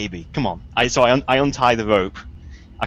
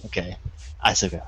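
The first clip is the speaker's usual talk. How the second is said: ordinary delivery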